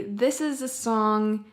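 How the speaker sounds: usual speaking voice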